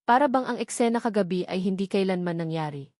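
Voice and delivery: usual speaking voice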